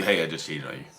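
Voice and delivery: low, soft, matter-of-fact mock confession; straight-faced. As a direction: deliver the confession deadpan. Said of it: "with a straight face".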